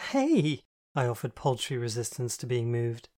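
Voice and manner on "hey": high, loud protest; indignant yelp. A narrator's reading. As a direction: squeak out an objection. Protest at being pushed.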